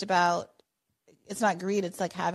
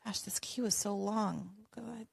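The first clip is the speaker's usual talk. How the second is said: whispering; 5 dB quieter than usual. Real, not acted. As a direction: whisper it to yourself. Host aside while scanning queue.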